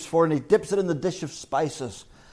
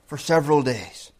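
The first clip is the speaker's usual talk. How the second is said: ordinary delivery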